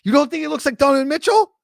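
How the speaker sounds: loud, high-pitched incredulous question; real, animated astonishment; disbelief. Real, not acted. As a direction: challenge it in rising disbelief. Disbelieving challenge to co-host.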